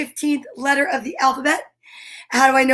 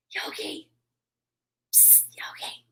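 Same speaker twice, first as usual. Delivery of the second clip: soft whispered call; 18 dB quieter, slower than usual. Real, not acted. Host coaxing her camera-shy pet Yogi, whispered. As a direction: coax the pet over under your breath.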